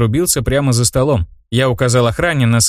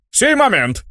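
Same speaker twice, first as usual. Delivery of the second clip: high eager call of an innkeeper; innkeeper's shout; 10 semitones higher, slower than usual. A narrator's reading. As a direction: shout it back, eager to serve. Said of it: "крикнул трактирщик". (услужливый крик трактирщика)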